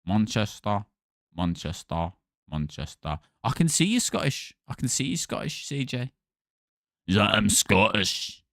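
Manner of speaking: low soft Manchester sliding into Scottish; slipping Manchester accent. Shown as a demonstration. Streamer's Manchester accent slips into Scottish. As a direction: Manchester that keeps turning Scottish. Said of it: "Why am I doing Scottish there?".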